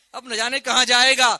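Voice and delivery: loud surge of exclamation; rousing preaching. Real, not acted. Preacher builds to the Quran's verdict, rousing and loud. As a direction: lift it to a ringing peak.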